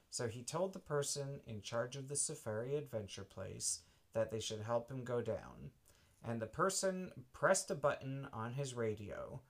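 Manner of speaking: ordinary delivery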